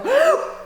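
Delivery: sharp high gasp of breath; demo gasp. Shown as a demonstration. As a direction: gulp in air to show how. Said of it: "Hold your breath like this".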